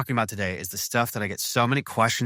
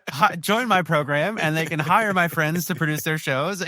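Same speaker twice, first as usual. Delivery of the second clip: laughing; 7 semitones higher than usual. Real, not acted. Laughing over his own joke.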